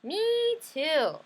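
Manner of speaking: bright tagalong sister calling it out; eager little sister piping up. Acted.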